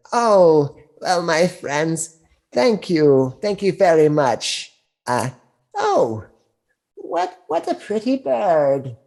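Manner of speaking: put-on high, slow sweet old crone; a kindly old hag cooing. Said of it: "the old crone says".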